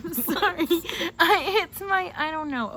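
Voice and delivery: high, loud, breaking into laughter; laughing. Real, not acted. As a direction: apologise while cracking up. Laughing fit apologising.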